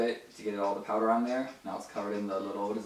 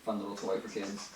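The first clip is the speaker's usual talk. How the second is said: ordinary talk, nothing marked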